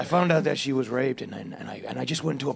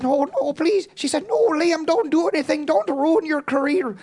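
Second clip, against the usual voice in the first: put-on high, pleading girlfriend voice, louder; girlfriend's plea; 14 semitones higher, 4 dB louder than usual. Guest voices his girlfriend begging him to stop, high falsetto. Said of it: "And she said".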